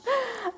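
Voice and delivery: high, soft little laugh; light self-amused laugh. Real, not acted. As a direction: let out a small high giggle. Laughs after promising she's nice.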